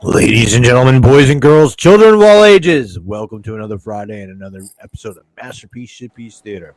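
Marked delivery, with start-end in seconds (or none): 0.0-3.0 booming ringmaster call, rising. Acted. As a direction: announce it like a circus ringmaster. Show host opening announcement.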